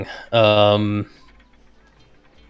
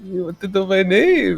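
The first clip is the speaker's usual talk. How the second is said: merchant voice; 8 semitones higher than usual. Acted. Merchant NPC asked his name.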